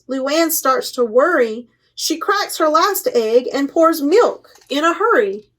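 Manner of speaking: plain reading voice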